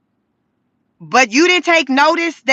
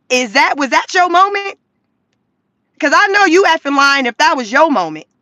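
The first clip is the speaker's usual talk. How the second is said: normal talking voice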